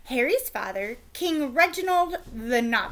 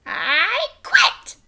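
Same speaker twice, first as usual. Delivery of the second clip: much higher, louder, slow furious outburst; steaming knight; 11 semitones higher, 11 dB louder, slower than usual. A narrator's reading. Knight furious at the prince's pranks, shouting high. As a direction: explode like an insulted knight. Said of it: "steamed Sir Bedwetter".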